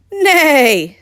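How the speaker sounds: high, loud contented whinny; pleased horse neigh. Acted. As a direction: neigh happily. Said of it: "said the Horse with pleasure".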